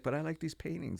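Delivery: high pleading little voice; mock whiny protest. You protesting to God, whiny and high. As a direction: whine it like a kid losing his toys.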